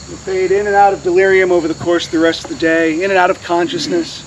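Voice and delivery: normal talking voice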